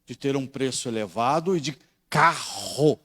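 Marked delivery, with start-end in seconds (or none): 1.6-3.0 carro with a strong aspirated double R, slow; R forte. Shown as a demonstration. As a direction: lean on the rr in carro. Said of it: "mesma coisa nossa de caro". ("carro" com RR aspirado e forte)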